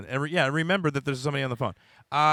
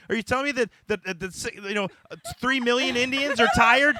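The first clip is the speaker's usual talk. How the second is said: higher-pitched, incredulous protest; indignant; 9 semitones higher, 7 dB louder than usual. Real, not acted. Comic defending his accent bit to a co-host, indignant. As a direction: challenge them in rising, heated disbelief.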